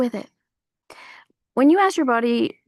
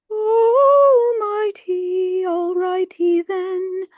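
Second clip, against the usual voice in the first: much higher, very slow, loud drawn-out catchphrase; Jim Carrey; 10 semitones higher, 5 dB louder, much slower than usual. Retold or imitated. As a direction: stretch it like a rubber-faced comic. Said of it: "I miss Jim Carrey".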